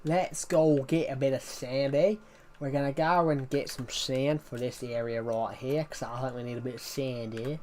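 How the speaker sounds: mock Aussie accent, lightly done; Australian accent. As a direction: put on a playful Aussie twang while building. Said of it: "it's an Australian accent".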